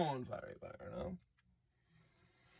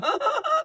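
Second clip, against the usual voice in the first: high mock laugh, much higher; imitating a ridiculous high laugh; imitated ridiculous laugh. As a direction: cackle like the singer. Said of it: "he does his thing".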